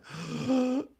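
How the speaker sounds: a sharp, high gasp of shock. Retold or imitated. Recalling the hospital visit, a sharp shocked gasp. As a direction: gasp as if taken aback. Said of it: "I was just taken aback".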